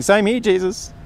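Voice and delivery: a light, eager me-too; chirpy agreement. Retold or imitated. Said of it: "they chirp in the same thing".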